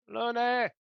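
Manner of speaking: loud, high shout; yelled name. Pretend. Player yelling Lune's name again in the game, shouted. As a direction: call out the name at a full yell. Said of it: "I'm gonna yell Lune's name again".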